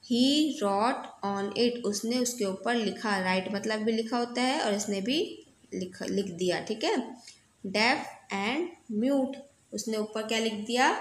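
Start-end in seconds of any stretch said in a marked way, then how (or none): 0.0-1.8 very slow, word by word; slow reading aloud. Shown as a demonstration. Teacher reading the story line to students, very slow. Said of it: "reading aloud to class". 7.6-9.3 very slow, word by word; slow reading aloud. Shown as a demonstration. Teacher reading the story line to students, very slow. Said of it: "reading aloud to class".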